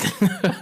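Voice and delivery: bright laugh that breaks out, then falls away; a quick real laugh before going on; real burst of laughter. Real, not acted. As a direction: laugh out loud at the memory. Laughs retelling the surprise call.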